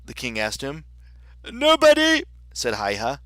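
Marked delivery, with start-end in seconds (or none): 1.4-2.2 high, loud messenger voice; messenger Haigha. Acted. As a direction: blurt it out as the messenger. Quite out of breath.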